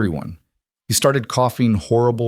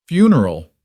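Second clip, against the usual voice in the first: a mocking echo, louder and higher; humorless grin; 5 semitones higher, 6 dB louder than usual. A narrator's reading. Outlaw leader mocking the traveler, grinning without humor. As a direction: sneer the word back at him. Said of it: "repeated with a grin that held no humor".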